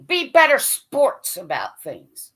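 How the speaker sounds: ordinary talk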